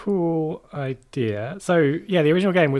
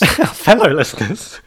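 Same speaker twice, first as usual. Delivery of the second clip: amused disbelief at "Fellow listeners"; incredulous echo; 2 semitones higher, 7 dB louder, slower than usual. Real, not acted. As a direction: repeat "Fellow listeners" like it's absurd. Echoes partner's odd phrase.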